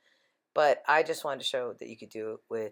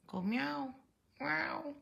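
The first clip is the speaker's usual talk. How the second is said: much higher sing-song prompt; high coaxing; 12 semitones higher, much slower than usual. Real, not acted. Asking the cat to meow, high coaxing meow.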